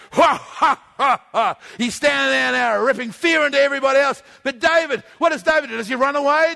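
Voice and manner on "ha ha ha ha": loud bellowing mock laugh; giant's booming laugh. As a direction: boom it out like a giant. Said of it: "his big bass voice bellowing out".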